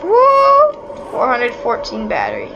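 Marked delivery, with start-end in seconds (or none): 0.0-0.7 excited whoop. Real, not acted. Celebrating a find.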